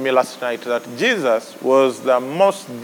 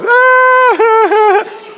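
loud high laugh; 7 dB louder than usual. Real, not acted. Preacher laughing at his headquarters joke, loud.

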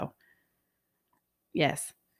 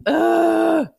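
high, loud drawn-out groan; exasperated groan; 9 semitones higher, 5 dB louder than usual. Real, not acted. Customer annoyed by nice barista, loud exasperated groan. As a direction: groan out your annoyance, pitched up.

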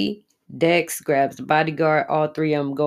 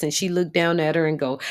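normal talking voice

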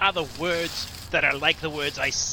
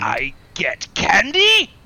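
loud, slow, drawn-out delighted question; thrilled disbelief; 9 dB louder, slower than usual. Acted. Demon trick-or-treater learns candy is coming, loudly thrilled. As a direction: stretch it out with excited amazement.

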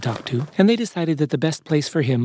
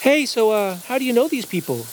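high, casual character voice; high, easygoing, slightly projected question; asking through a door; 9 semitones higher than usual. A narrator's reading. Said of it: "he asked through the door".

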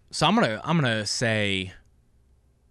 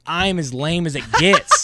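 laughing; 5 semitones higher, 6 dB louder than usual. Real, not acted. Self-deprecating joke, laughing.

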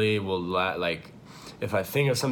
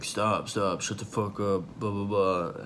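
mild imitation of someone yelling; half-hearted retold yell, no real volume; mock yell. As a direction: imitate an upset boyfriend yelling, without shouting. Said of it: "yelling, like".

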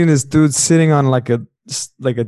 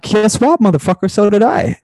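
excited reply; 7 semitones higher than usual. Retold or imitated. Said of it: "I'm like".

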